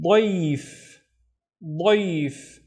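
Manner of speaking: dayf with a heavy emphatic dh. Shown as a demonstration. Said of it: "la, the heavier version of da".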